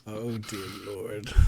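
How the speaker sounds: low, slow, soft groan of despair; exasperated, under the breath; weary dismay. Real, not acted. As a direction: sigh it out slowly, sunk low. Dismay at a bad joke.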